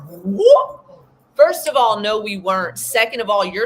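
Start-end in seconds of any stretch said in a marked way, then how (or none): 0.1-0.8 whoop. Real, not acted. Exclamation at the turnaround. 1.3-2.2 fired-up retort. Retold or imitated.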